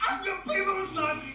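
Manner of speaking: Bobcat Goldthwait. Acted. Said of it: "voice of the sentient cruise ship".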